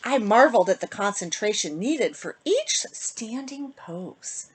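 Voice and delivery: put-on lilting rhymed read-aloud for kids; storybook reading. A narrator's reading. Host reading a bedtime rhyme to kids, lilting. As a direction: read the rhyme to children, sing-song. Reads picture book to children.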